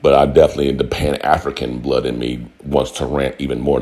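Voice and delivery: normal talking voice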